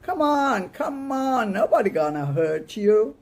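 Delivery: high, loud Brooklyn shouting. Acted. Eugene hollers at the boys in a Brooklyn accent, loud and high. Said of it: "shouting in a rank Brooklyn accent".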